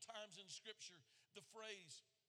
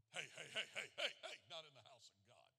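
put-on old pastor scolding; 5 semitones lower, 4 dB louder than usual. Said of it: "days of Brother Price being the pastor".

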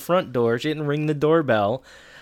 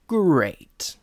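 sarcastic; 3 semitones higher than usual. Real, not acted. Sarcastic forecast of how it goes.

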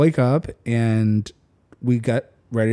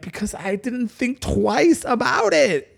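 higher and drawn out, naive; innocent excuse; 8 semitones higher, slower than usual. Retold or imitated. Excusing himself to David, higher and slow. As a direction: shrug it off innocently. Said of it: "I'm like".

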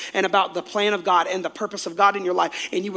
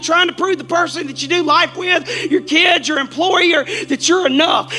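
loud, high preacher's shout, slowing on the ends; preaching at full force; 10 semitones higher, 7 dB louder than usual. Acted. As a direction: drive it home to the whole church at full voice. Preaching climax to congregation.

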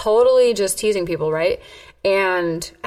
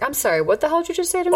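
high, rapid challenge; retold confrontation; 8 semitones higher, much faster than usual. Woman replaying her answer to a hair-pull threat, quick high challenge. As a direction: fire back in mock disbelief, pitch up.

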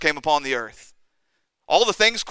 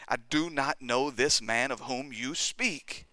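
slow, soft, measured oath; solemn swearing; 9 dB quieter, slower than usual. Retold or imitated. Peter swears before the bystanders, slow solemn denial. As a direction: read the oath out deliberately, word by word. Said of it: "to invoke a curse on himself and to swear".

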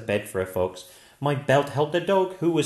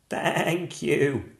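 goat voice; 4 semitones higher than usual. Acted. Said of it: "said the goat".

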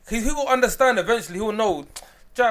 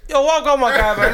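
street greeting; 7 semitones higher, 6 dB louder than usual. Retold or imitated. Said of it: "he'll see Abs like".

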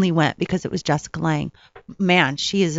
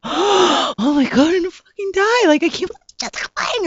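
starstruck gush; 10 semitones higher than usual. Retold or imitated. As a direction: gush like you might faint from excitement.

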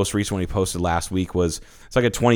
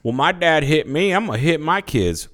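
put-on Southern accent; 8 semitones higher than usual. Mocking parents who repeat bad examples, Southern accent. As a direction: do an exaggerated Southern good old boy. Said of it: "do a Southern accent".

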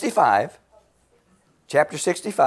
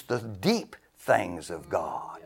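slow, soft hushed reverence; whisper; 8 semitones lower, 9 dB quieter, much slower than usual. Acted. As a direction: lean in and whisper the mystery. Hushed preaching.

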